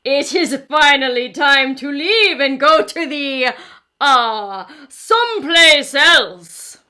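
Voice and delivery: slow booming dinosaur shout at the end; loud booming voice. Acted. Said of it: "in his loud, booming voice".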